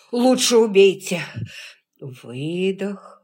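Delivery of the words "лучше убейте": gasping outburst, much louder; breathless shout. Acted. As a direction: spit it out with last breath. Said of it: "Выдох, вдох". (крик сквозь удушье)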